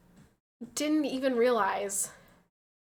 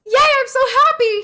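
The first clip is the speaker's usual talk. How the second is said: squealed high and loud; mock cheerful; 13 semitones higher, 14 dB louder than usual. Wife mocking her own rare happy morning, high and loud. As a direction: gush like a giddy morning person. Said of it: "I was like".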